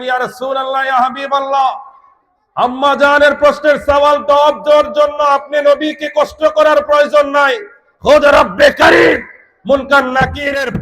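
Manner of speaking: high, loud sermon delivery; impassioned preaching. Real, not acted. Preaches at full force. (উঁচু গলায় আবেগী ওয়াজ)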